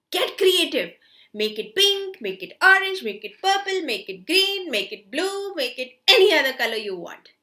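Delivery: excited encouragement. Real, not acted. Encouraging kids, colour list.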